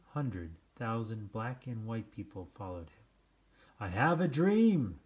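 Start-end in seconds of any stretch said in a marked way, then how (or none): 3.8-5.0 loud, high, ringing preacher-style oratory; speech oratory. Acted. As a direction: proclaim it to a huge crowd. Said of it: "he said in his speech".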